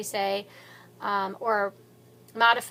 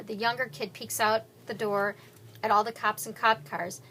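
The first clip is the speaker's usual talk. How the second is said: ordinary delivery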